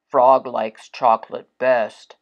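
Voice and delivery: a hint of a low character voice for Toad; Toad's voice. Toad's voice in read story.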